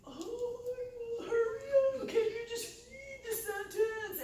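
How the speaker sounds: high, loud whiny hurry up; mock-exasperated student urging a slow reader; impatient classmate. Classmate to slow reader in class: high impatient whine. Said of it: "and it's like".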